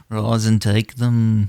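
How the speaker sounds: put-on slow Liverpool accent through a laugh. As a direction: laugh it out in a Liverpool Obi-Wan voice. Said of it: "our Liverpool Obi-Wan".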